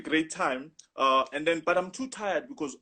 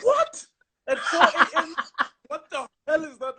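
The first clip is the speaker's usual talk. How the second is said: high, loud incredulous laughing yelp; shocked disbelief; 9 semitones higher, 5 dB louder than usual. Retold or imitated. Shocked at boyfriend news.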